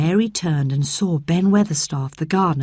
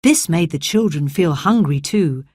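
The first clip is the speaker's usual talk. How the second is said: plain reading voice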